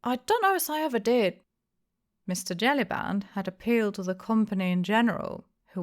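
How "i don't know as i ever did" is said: put-on high, quick old-man whine; feeble denial. A narrator's reading. Hempseed denying to the innkeeper, high and feeble. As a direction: deny it weakly and fast.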